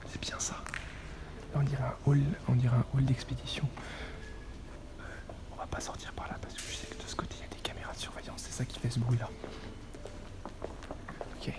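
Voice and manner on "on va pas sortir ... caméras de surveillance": whispered warning about cameras; whispering. Real, not acted. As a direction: breathe it, someone might hear.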